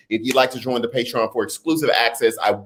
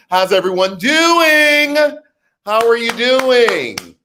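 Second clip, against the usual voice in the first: excited greeting; 8 semitones higher, 4 dB louder, much slower than usual. Real, not acted. Greeting the live chat.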